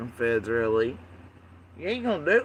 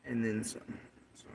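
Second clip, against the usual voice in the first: normal talking voice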